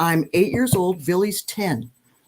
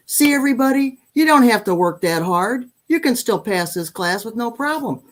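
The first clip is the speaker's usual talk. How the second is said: raised, higher voice calling to the class; teacher announcing to class; 7 semitones higher, 4 dB louder than usual. Retold or imitated. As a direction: announce it to the whole room. Teacher addressing whole class.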